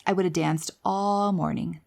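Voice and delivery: ordinary talk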